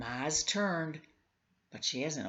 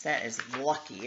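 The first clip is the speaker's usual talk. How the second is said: usual speaking voice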